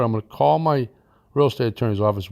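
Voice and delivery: normal talking voice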